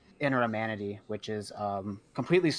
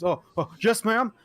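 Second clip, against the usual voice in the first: high, flustered yes-ma'am from a jumpy servant; startled and eager to please, voiced up high; nervous half orc; 10 semitones higher, 5 dB louder than usual. Half orc cook answering his boss, jumpy and high. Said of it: "He goes".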